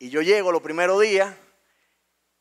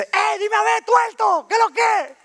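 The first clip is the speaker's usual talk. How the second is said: put-on very high, loud heckling kid voice; taunting schoolmate; 13 semitones higher, 4 dB louder than usual. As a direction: call out a taunt, pitched way up. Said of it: "viene uno y dice". (compañero burlón gritando en voz aguda)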